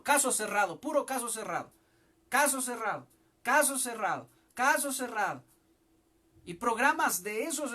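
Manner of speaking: loud, driving sermon cadence; full-force preaching. Acted. Preacher rallying the congregation, loud full-force preaching.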